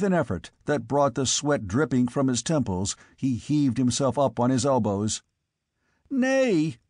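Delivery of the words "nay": high, urgent cry. Acted. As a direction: cry out in despair. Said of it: "cried the squire".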